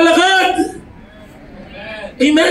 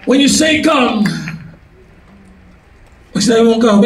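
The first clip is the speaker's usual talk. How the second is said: normal talking voice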